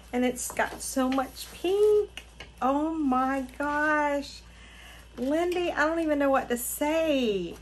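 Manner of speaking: high, loud, gushing amazement; overwhelmed delight. Real, not acted. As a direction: gush as if lost for words. Overwhelmed by the gift.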